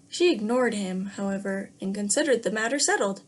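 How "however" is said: plain reading voice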